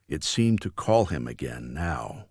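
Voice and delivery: ordinary delivery, nothing marked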